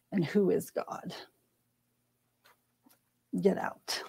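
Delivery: hushed whisper of scornful townspeople. Retold or imitated. Townspeople dismissing Alma, whispered with scorn.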